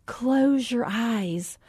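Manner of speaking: slow, soft meditation-teacher voice; zen instructor. Retold or imitated. Instructor addressing her only student, slow soft zen voice. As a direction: float it out like a calm yoga guide. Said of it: "she's kinda got her zen on".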